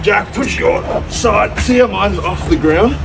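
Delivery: strained high voice while lifting; pushing hard, voice tight with effort; carrying heavy load. Real, not acted.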